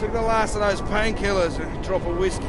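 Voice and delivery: very high, loud boozy holler; loud merry call from far away; tipsy calling out. Acted. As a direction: shout it back across the distance, half drunk.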